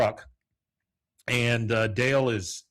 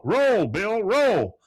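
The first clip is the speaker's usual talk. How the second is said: loud, raised-pitch shouted order; urgent mock shout; 11 semitones higher, 7 dB louder, much slower than usual. Hank tells burning Bill to roll, shouting. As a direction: call it out urgently across the yard. Calling to man on fire.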